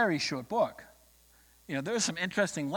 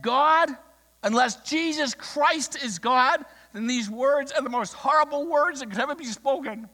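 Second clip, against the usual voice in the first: loud, high retold cry to heaven; impassioned protest, acting out the preacher; fist-shaking outcry; 7 semitones higher, 6 dB louder than usual. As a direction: preach it as a raised-voice challenge to God. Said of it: "shook his fist towards heaven".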